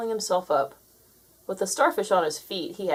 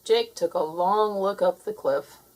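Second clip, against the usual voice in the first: ordinary delivery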